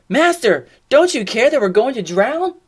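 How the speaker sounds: high, loud, urgent plea; acted alarm. Disciples waking Jesus in the storm, alarmed pleading. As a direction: plead loudly as if in danger.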